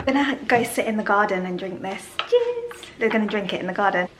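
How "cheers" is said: high bright cheers; cheerful toast. Real, not acted. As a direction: raise the glass gleefully. Toasting with juice.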